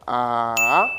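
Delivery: A held long and low, then swooping up; dipping third tone. Shown as a demonstration. Teacher demonstrating the third tone, A falling then rising. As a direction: sink low on A, linger, then climb at the end. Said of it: "Donc c'est comme ça".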